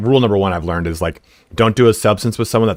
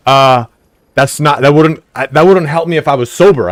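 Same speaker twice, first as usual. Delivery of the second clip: exasperated burst; 4 semitones higher, 6 dB louder than usual. Retold or imitated. Exasperated reply to girlfriend.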